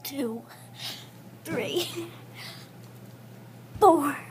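straining, breathless count; doing push ups. Real, not acted. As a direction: count out loud through the strain. Push-ups counted during one minute.

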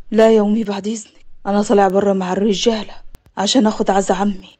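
low-pitched man's voice, subdued; low, sober introduction; composed returning nephew. Acted. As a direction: play the man deep and steady.

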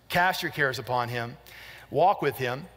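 usual speaking voice

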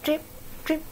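ordinary delivery, nothing marked

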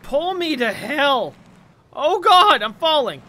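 loud slow panicked cry; panicked yell. Real, not acted. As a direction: yell it as you slip. Game frustration cry.

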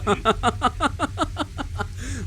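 long high-pitched giggle fit. Real, not acted. Gamer cracks up at the monster, high giggle. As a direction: break into a squeaky laugh. Laugh alone.

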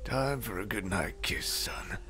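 soft whispered goodnight; hushed bedtime whisper. Acted. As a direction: breathe it gently to the child.

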